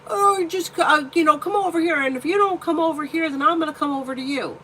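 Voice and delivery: high, loud mock voice of the pushy boyfriend; imitating the boyfriend's careless coaxing in a high voice. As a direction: ham up his careless pleading, pitched high. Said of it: "And he's like".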